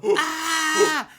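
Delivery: high loud scream of pain. Retold or imitated. Retelling being on fire, high loud scream. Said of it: "I'm screaming".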